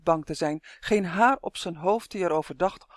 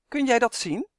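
higher, mildly astonished question; verbaasd; 6 semitones higher, 4 dB louder than usual. A narrator's reading. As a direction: ask it with a touch of surprise, pitch climbing. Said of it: "vroeg Malien verbaasd". (licht verbaasde vraag)

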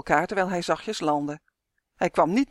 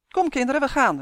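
high, raised summoning call; 7 semitones higher, 5 dB louder than usual. A narrator's reading. Tonia to the children as she leaves, calling out. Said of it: "riep Tonia".

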